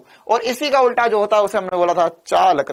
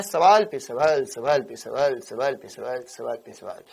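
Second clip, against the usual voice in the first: fading repetition; 10 semitones lower, 6 dB quieter than usual. Real, not acted. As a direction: trail down from normal voice to low, near-muttered repeats. Repeats phrase to stress endless questions.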